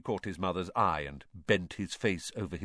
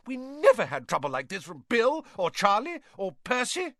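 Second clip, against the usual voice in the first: high, loud scolding mother's tirade; furious mother; 6 dB louder than usual. A narrator's reading. Mother scolding her sons for taking the car, furious and loud. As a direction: let her rage break over them, pitched up and loud. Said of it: "her rage broke over them".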